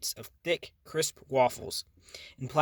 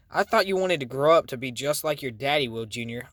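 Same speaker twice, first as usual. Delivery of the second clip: ordinary delivery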